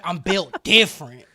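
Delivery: loud mock brag, dropping slightly in pitch; flexing braggart. Goblin Slayer flexing beside the guys, loud mock bragging. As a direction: puff up and boast it loudly. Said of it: "And he's just like".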